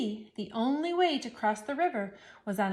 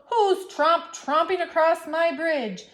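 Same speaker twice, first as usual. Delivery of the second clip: high, loud troll roar; 6 semitones higher, 5 dB louder than usual. Acted. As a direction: bellow it like a troll. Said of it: "A roar came up from below".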